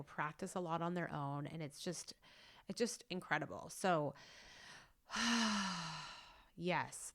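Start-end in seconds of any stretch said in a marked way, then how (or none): 5.1-6.2 big audible exhale; long sigh. Real, not acted. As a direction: let out a long winding-down sigh. Winding down after exhausting story.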